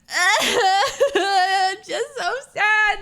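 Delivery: high, loud, drawn-out wail; hammed-up crying complaint; mock sobbing. Imitating an upset girl in the bathroom, mock sobbing.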